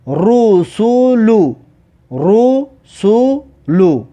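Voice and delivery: رسول stretched as /rusulu/; slow Arabic reading. Shown as a demonstration. Teacher drilling pupils on a Quran word, slow recitation. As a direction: draw out رسول with full vowels. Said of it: "و اینجا".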